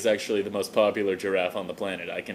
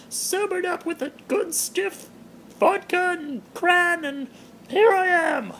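Terry the giraffe. Acted. Terry the giraffe greeting her blog fans in falsetto. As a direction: greet the fans like a tipsy celebrity in a high voice.

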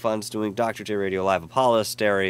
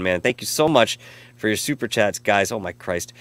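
ordinary talk

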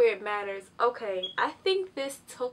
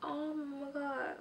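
awed; 6 semitones higher, much slower than usual. Real, not acted. Awed by result.

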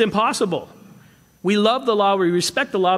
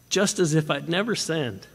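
ordinary talk

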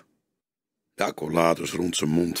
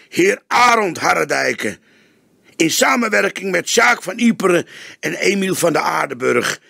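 higher and louder, pressing demand; detective grilling the journalist, stern; stern interrogating detective; 9 semitones higher, 7 dB louder than usual. A narrator's reading. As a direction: pin the suspect down with every phrase. Detective interrogates suspect hard.

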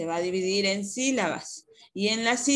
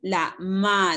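ordinary talk, nothing marked

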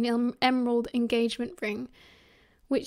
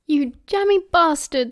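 loud, raised, thrilled exclamation; delighted whoop; 4 semitones higher, 7 dB louder than usual. A narrator's reading. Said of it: "Sirius whooped".

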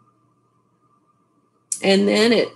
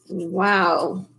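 plain reading voice

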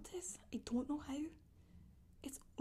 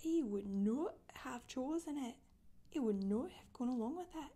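incredulous, pitch swooping up on the name; indignant disbelief; 3 dB louder than usual. Acted. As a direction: protest the new name, leaning hard on the refusals. Thinking in dismay at new name.

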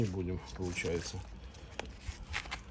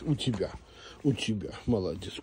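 usual speaking voice

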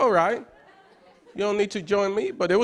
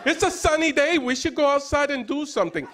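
pitched-up, chirpy imitation of himself; lightly hammed-up happy homebody; mock cheerful suggestion; 8 semitones higher than usual. Quoting himself telling his wife, cheerful. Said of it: "I've said that out loud as a man".

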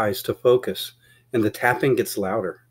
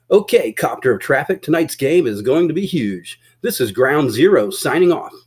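higher, louder broadcast delivery with swooping emphasis; hyped radio anchor; 4 semitones higher, 5 dB louder than usual. Acted. Studio anchor answers the copter and signs off, hyped. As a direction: sell the big game to listeners.

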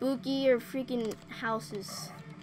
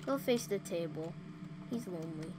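usual speaking voice

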